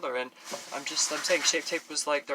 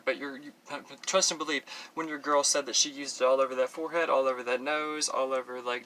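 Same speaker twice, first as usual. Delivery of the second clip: usual speaking voice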